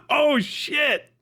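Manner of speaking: ordinary talk, nothing marked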